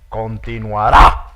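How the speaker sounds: loud, slow dramatic close; a mock TV announcer ending the episode; soap opera announcer. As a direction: draw out the cliffhanger. Mom imitating soap opera end.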